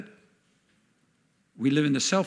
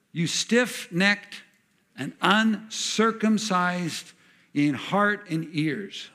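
slow, deliberate indictment; slow rebuke; 3 semitones higher, much slower than usual. Acted. Stephen rebuking the council, slow and weighty. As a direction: spell out each charge slowly.

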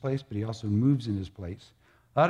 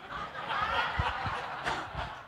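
high breathy laugh; 9 dB quieter than usual. Real, not acted.

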